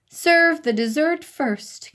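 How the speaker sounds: high, slow kingly command; royal command. A narrator's reading. King Wacky commanding the cooks, slow and grand. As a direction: command it with mock majesty. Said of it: "King Wacky commanded".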